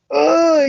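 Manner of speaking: loud, high groan of frustration; exasperated groan. Real, not acted. Exasperated outburst mid-rant.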